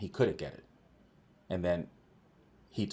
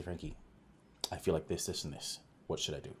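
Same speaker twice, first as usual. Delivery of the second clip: hushed asking; 3 semitones lower, 9 dB quieter than usual. Retold or imitated. Hygienist quietly asking for help.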